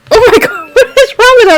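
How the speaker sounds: giggling horror; laughing shock. Retold or imitated. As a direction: squeal and laugh in disbelief. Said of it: "And I'm like".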